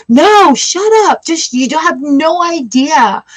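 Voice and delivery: high, slow, exasperated retort; mock the voice in your head telling them off; inner snap. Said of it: "you're realizing it's like".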